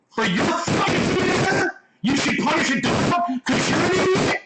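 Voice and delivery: high-pitched, incredulous angry questions; indignant, voice pitched right up; pissed off. Real, not acted. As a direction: throw outraged questions back at him. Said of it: "that fucking pisses me off".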